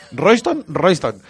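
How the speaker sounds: mock Senegalese accent. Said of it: "Ah, de Senegal".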